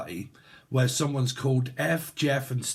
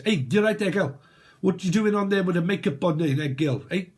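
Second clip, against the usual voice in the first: higher, rough attempt at South African; South African accent; 4 semitones higher than usual. Reader imitates someone from there, mock South African accent. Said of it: "South Africa, innit?".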